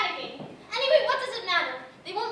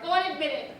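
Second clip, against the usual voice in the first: usual speaking voice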